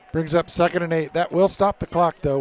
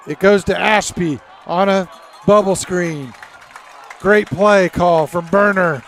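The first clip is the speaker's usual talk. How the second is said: excited play-by-play; 3 semitones higher, 7 dB louder than usual. Real, not acted. Live play call.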